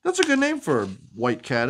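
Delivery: plain reading voice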